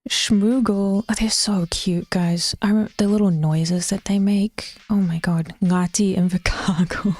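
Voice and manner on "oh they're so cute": ordinary talk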